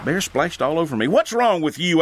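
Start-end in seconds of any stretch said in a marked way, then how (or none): 1.1-2.0 high, loud rebuke; angry yell. Acted. Narrator yelling at Jeff after beer splashes on him, a high loud angry yell. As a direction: snap at him, louder and higher. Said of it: "I yelled at him".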